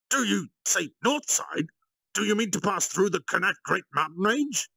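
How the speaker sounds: high and loud, as if sceptically checking the plan; Gladim asking. A narrator's reading. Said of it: "To Gladim's question".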